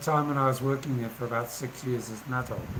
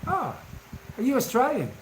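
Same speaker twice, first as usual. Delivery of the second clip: much higher voice, surprised question; imitated Australian lady; 10 semitones higher than usual. Australian lady at her door greeting the tiler, imitated high voice. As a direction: mimic a friendly Australian woman, pitched well up. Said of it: "And she goes".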